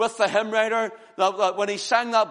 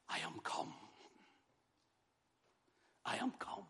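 hushed whisper; 21 dB quieter than usual. Acted. Preacher voicing Jesus to the hushed congregation, whispered. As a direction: breathe the words almost silently. Jesus' words voiced in hush.

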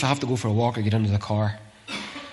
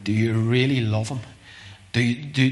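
ordinary talk

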